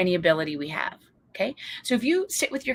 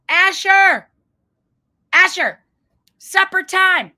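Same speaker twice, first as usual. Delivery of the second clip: loud, higher call down the stairs; yelling; 13 semitones higher, 12 dB louder, slower than usual. Retold or imitated. Mother yells to son in basement, loud call. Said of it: "I was yelling".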